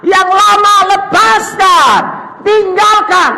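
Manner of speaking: high pulpit voice building to a shout; forceful preaching. Acted. Preacher urging believers to throw out the old, forceful preaching.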